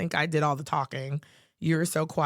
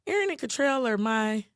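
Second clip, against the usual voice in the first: high, emphatic declaration; 9 semitones higher than usual. Retold or imitated. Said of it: "And Starla's like".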